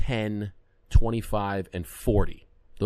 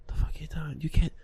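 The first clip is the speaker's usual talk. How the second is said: hushed, frantic whisper under the breath; panicked whisper; 4 semitones higher, 6 dB quieter than usual. Retold or imitated. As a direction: hiss it in a panic so nobody hears. Panicked aside, caught underage.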